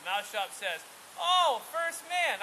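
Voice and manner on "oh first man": high, loud, drawn-out greeting; delighted recognition. Acted. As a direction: stretch out the "oh" as you recognize him. Creator greets the long-awaited first man.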